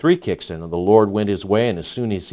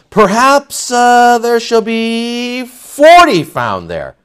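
high, loud, drawn-out plea; high-pitched haggling; 11 semitones higher, 7 dB louder, much slower than usual. Acted. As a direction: stretch it out high and slow as you push your luck. Acted plea bargaining with God.

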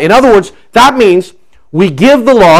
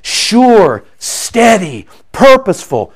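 ordinary talk, nothing marked

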